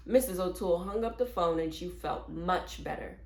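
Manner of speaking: usual speaking voice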